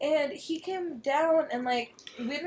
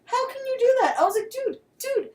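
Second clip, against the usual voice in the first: much higher, near-crying disbelief; high shocked outburst; 12 semitones higher than usual. Retold or imitated. Retelling her outburst at the hookup, shrill disbelief. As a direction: squeal it in outrage.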